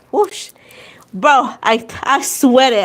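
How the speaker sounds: ordinary talk, nothing marked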